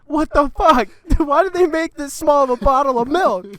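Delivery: high, loud mock disbelief; imitated baffled outburst. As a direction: exclaim like a flabbergasted friend, hammed-up. Acting out friend's baffled outburst.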